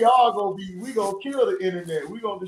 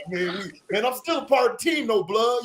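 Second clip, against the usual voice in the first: imitating [name]; 6 semitones higher, 4 dB louder than usual. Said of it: "[name] gonna come in there different".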